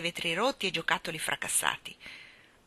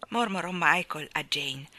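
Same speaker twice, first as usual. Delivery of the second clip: usual speaking voice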